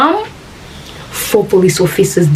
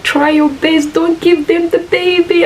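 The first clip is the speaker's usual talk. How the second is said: high, strained retold pleading; on the edge of tears; desperate plea; 10 semitones higher than usual. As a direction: plead urgently, pitch held way up. Retelling mother's plea during the struggle.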